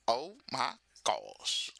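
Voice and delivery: slow, drawn-out exclamation; signature catchphrase. Shown as a demonstration. Streamer mimicking stuff they say on live, signature catchphrase. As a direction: stretch out each word as your trademark. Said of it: "stuff that I say".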